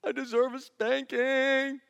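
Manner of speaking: put-on high, slow, pleading child; contrite kid voice. Dad voicing his son apologizing to him. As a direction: sound sheepishly sorry. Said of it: "And they".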